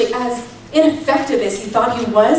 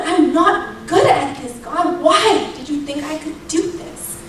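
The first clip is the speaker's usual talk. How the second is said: retold protest, higher, half laughing at herself; exasperated plea; 4 semitones higher than usual. Mom asks God why he picked her, exasperated plea. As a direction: plead upward in disbelief, faltering before the end. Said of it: "I'm dead serious in that moment".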